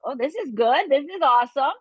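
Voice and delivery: high, delighted discovery; mocking her college self discovering alcohol; giddy young drinker. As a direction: savor it like the first drink. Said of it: "found alcohol".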